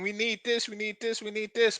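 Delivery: high, small repeated plea; mocking whine. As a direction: parody a needy begging voice. Mocking needy women again.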